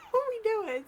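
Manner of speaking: high, laughing mock disbelief; incredulous. Reacting inwardly to Gary guarding the premises, incredulous. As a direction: let the question jump up into a laugh.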